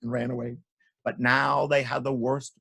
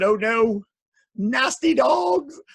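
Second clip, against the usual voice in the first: high, loud, stretched-out wail; mock dismayed cry; 10 semitones higher, 6 dB louder, much slower than usual. A narrator's reading. Townspeople fed up with dogs, loud theatrical dismay. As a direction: cry out like an alarmed crowd.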